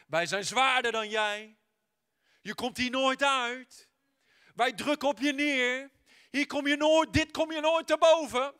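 mocking soil that swells to a shout; taunting soil. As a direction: voice of the soil, rising to a loud taunt.

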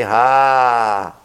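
father imitating a contented sigh; relieved sigh. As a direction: breathe out in relief. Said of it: "you say".

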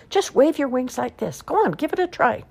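high, cheering-on wolf; Wolf urging his friend to flap, high and encouraging. Acted. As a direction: coax her up brightly. Wolf encourages owl to fly.

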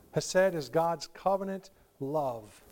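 ordinary talk

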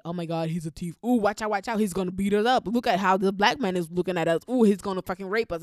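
loud, hammed-up fearful mockery. Classmate mocking migrants on the trip, loud hammed-up alarm. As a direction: shout it like a scared bigot mocking. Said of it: "he would, like, say".

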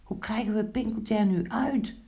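high, worried little mice; calling out. A narrator's reading. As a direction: call it high, at a loss. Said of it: "riepen de muisjes". (hoog en bezorgd roepend)

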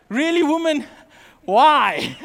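loud, incredulous son challenging his mother; retold indignant protest, pushed loud; exasperated.

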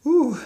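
high whooping breath out, then a laugh; emotional exhale and laugh. Real, not acted. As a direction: shake off the tears with a laugh. Composing self after tearing up.

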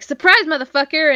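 cat's taunt. Retold or imitated. The cat ambushes the hitman with a gleeful mock shout. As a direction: yell it like a cat springing an ambush.

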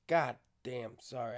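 ordinary talk

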